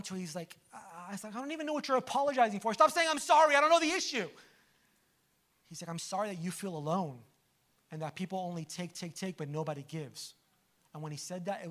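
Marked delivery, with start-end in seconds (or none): 1.4-4.3 exasperated protest. Retold or imitated. Preacher retells snapping at the apologizing man, exasperated. 5.9-10.3 soft, low, sincere retold apology; tender apology. Retreat man apologizing for his loneliness, tender and hushed.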